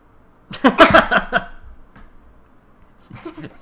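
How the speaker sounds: big high laugh; loud burst of laughter. Real, not acted. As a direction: crack up loudly. Laughing at John Cena joke.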